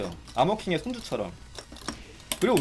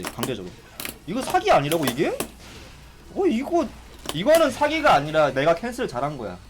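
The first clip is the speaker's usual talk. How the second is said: ordinary delivery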